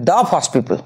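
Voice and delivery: দা as "duh" before first. Shown as a demonstration. Teacher applies the consonant rule, দা as "duh". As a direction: open vowel in দা, falling through people. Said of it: "উচ্চারণ হবে দা".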